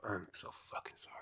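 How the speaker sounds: real, barely audible whisper; whispered apology. Real, not acted. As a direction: whisper it under your breath. Apologizing for the stream trouble.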